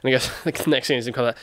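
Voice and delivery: ordinary talk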